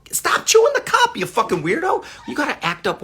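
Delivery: sudden high, loud snap at the pet; real scolding. Real, not acted. Breaking off the story to scold the pet, loud and high. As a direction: yell at the cup-chewer mid-sentence.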